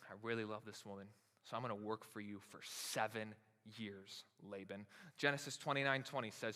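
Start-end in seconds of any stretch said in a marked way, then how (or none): 0.1-4.8 soft and slowing, a lovestruck promise; soft devoted vow. Acted. Jacob promising Laban seven years of work for Rachel, softly. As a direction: say it quietly, heart on sleeve.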